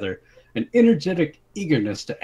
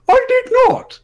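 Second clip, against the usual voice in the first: high, loud clipped denial; suppressed shout; 9 dB louder than usual. Acted. King denying it to the men, holding back a shout. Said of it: "swallowing his urge to shout".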